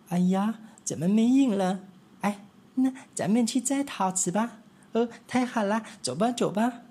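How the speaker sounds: high, lively little mouse voices; little mice. Acted. Three little mice chattering to each other, high and eager.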